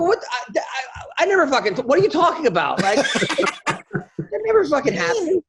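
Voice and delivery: high, shocked denial, breaking into a laugh; indignant disbelief. Retold or imitated. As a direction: protest it, half laughing. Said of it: "I go".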